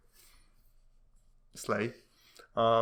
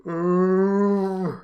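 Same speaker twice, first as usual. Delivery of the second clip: impressed ooh; 7 semitones higher, 7 dB louder than usual. Real, not acted. Reacting to a sick burn.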